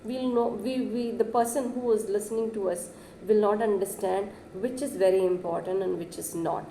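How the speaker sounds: ordinary delivery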